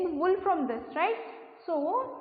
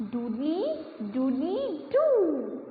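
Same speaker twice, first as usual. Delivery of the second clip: rooster crow; slower than usual. Shown as a demonstration. Teacher shows kids the rooster's morning crow. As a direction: crow like a cockerel at dawn. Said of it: "How does it sound?".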